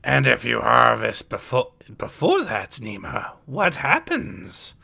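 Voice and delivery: put-on wise old teacher with swooping pitch; Yoda's voice. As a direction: question the pupils as the old sage.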